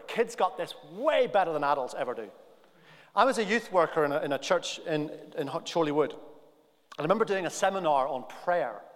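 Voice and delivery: ordinary talk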